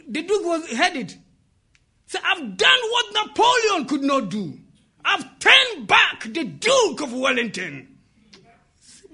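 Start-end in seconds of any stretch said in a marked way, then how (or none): 2.2-8.0 high, triumphant retold shout; boy shouting. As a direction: proclaim it like a victorious boy. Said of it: "he heard the boy shouting".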